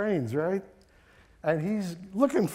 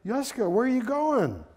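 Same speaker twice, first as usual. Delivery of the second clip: high, raised-voice greeting across distance; shouting; 9 semitones higher, 7 dB louder than usual. Acted. Happy friend hailing Yuska on the train, shouting. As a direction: call up loudly to a friend. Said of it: "Zeidemann shouted".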